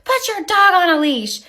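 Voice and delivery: high nagging mock voice; mocking the neighbor. Imitating the neighbor lady demanding a leash, high and nagging. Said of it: "And she's like".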